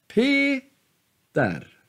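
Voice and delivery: Peter split slowly, long high Pe, falling ter; acute accent. Shown as a demonstration. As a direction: hold Pe high, then drop on ter. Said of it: "Samma är det med".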